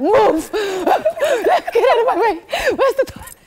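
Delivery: laughing high yell, desperate; urgent shouting. Retold or imitated. Acting out desperate rush to toilet.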